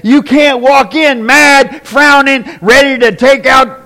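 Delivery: loud, high, driving preacher's cry; fiery pulpit voice; full-force preaching. Acted. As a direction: preach it out to the back row.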